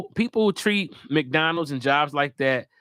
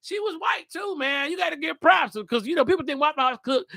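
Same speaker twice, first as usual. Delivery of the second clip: excited; 9 semitones higher than usual. Real, not acted. Excited about Waffle House video.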